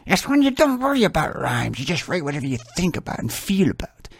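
put-on Russian-accented teacher explaining; mock Russian accent. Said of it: "She was Russian".

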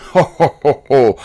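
a booming jolly ho-ho laugh; hearty ho ho laugh. Shown as a demonstration. Preacher imitating one kind of Japanese laugh, jolly ho-ho. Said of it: "Some Japanese laugh".

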